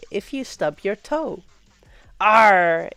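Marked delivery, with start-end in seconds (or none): 2.2-2.9 loud, drawn-out arr; hearty catch-all exclamation; loud pirate arr. Shown as a demonstration. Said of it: "is a catch-all exclamation".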